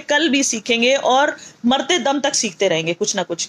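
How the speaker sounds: ordinary delivery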